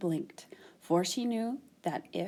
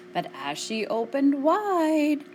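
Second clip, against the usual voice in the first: ordinary delivery, nothing marked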